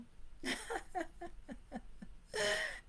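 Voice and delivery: soft, high-pitched laughing fit; high, quiet peal of laughter; high giggle. Real, not acted. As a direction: giggle helplessly to yourself. Laughs at own remark.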